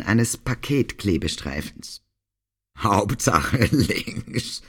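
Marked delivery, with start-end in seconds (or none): 2.8-4.6 slow, throaty man's voice with a laugh; throaty Harry impression. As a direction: drag it out gutturally, laughing. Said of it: "imitierte Ricarda kehlig Harrys Stimme".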